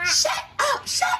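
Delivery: grandma shrieking at noisy grandkids; grandma yelling. Retold or imitated. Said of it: "she was like".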